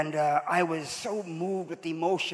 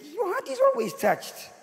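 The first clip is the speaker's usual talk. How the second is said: teasing retort; 12 semitones higher than usual. Retold or imitated. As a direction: voice leaps up high, mocking. Teen mocks crying mother.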